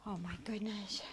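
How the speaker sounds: breathless whisper, no voice at all. Real, not acted. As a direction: whisper it so no one wakes. Whispering at night, lights off.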